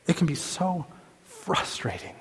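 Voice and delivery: breathy whisper of frustration. Acted. Preacher whispering about the war of sin. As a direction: whisper it, worn down. Sermon, voice drops.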